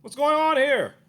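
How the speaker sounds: high, loud demand. Retold or imitated. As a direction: raise your voice and demand it. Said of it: "He said".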